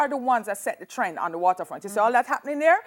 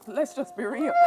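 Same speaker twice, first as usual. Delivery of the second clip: laughing; 3 semitones higher, 8 dB louder than usual. Real, not acted. Designer laughing after joking about her fees.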